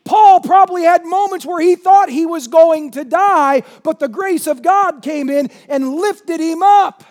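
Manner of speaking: loud, high, fervent preaching at full force. Acted. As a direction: thunder it from the pulpit to the congregation.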